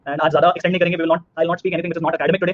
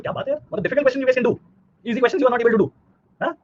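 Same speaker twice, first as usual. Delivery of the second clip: teasing; 7 semitones higher than usual. Real, not acted. Teasing students, easy vs difficult.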